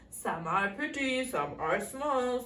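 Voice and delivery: low, measured intoning; magic mirror. A narrator's reading. Magic mirror answers the queen, low solemn intoning. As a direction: chant it as a solemn oracle. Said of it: "The mirror answers".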